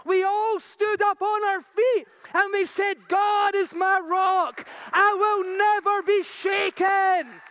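loud, high preaching at full force; shouted declaration of faith; rousing shout. Real, not acted. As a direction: proclaim it to the whole church.

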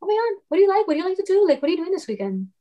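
high, eager small talk; chatty distraction. Retold or imitated. Helper to panicking coworker at work, high and chatty. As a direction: re-enact cheerfully distracting someone, pitch jumping up.

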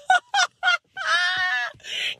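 a high burst of real delighted laughter. Real, not acted. Vlogger laughing at the unbelievable coincidence, delighted laugh.

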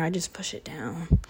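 hushed whisper to herself. Real, not acted. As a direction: drop to a breathy whisper. Muttered aside after confession.

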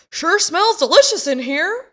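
high, loud call from behind; cheery interruption. Retold or imitated. Supposedly dead man walks into the kitchen, high loud exclamation.

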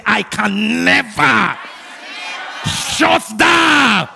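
slow, high shouted declaration; emphatic, with attitude. Shown as a demonstration. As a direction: hurl it out loud, stretching the words. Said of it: "say it emphatically with attitude".

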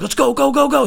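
high, repeated urging; urgent getaway cry. Retold or imitated. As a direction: urge the driver on in a high voice. Friends urging getaway, acted.

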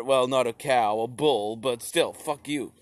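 ordinary talk

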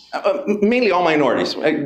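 ordinary talk, nothing marked

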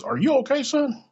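high, loud mock [name]. [name] checking on him from the stage, high and loud. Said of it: "he says".

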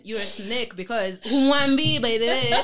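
loud, hammed-up tirade; mock accusation. Content creator mimicking haters' abuse, loud mock rant.

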